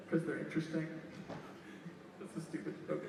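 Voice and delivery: ordinary delivery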